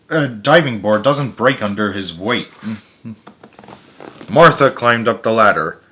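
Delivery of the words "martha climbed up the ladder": plain reading voice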